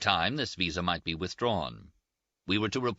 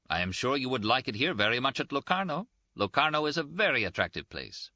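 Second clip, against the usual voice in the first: higher-pitched, mildly insistent salesmanship; lightly voiced official; 7 semitones higher than usual. Official promoting Locarno to travellers, lightly insistent higher voice. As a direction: press the case for your town.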